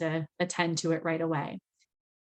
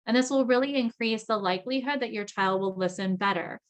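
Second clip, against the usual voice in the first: ordinary talk, nothing marked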